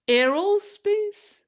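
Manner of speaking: aerospace rising high and loud, starting like air; unsure try, high and loud. Shown as a demonstration. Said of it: "how do you say this word again".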